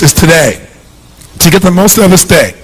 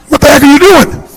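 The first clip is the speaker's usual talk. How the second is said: brain's voice; 9 semitones higher than usual. Retold or imitated. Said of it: "your brain goes".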